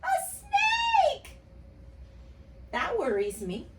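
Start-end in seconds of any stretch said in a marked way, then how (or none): 0.0-1.4 very high mock shriek; scared shriek. Teacher imitating herself seeing a snake, mock shriek. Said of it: "I go".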